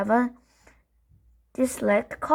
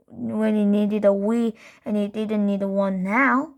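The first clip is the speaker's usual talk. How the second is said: plain reading voice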